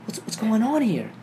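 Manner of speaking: high, frightened wail; crying in fear. Retold or imitated. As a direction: plead it out near tears. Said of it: "I'm, like, crying".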